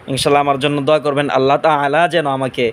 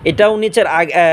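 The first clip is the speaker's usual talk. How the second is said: ordinary delivery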